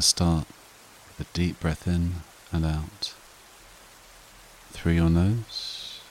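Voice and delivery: soft, unhurried meditation voice with long pauses; guided meditation. Acted. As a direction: gently guide them through breathing and letting go. Leads a breathing meditation.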